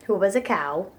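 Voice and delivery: usual speaking voice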